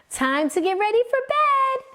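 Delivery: high, carrying call from a mother to her son. A narrator's reading. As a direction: call up the stairs, bedtime. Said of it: "his mother called".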